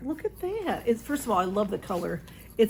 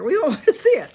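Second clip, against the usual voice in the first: laughing; 7 semitones higher, 10 dB louder, faster than usual. Real, not acted. Laughing at cat's antics.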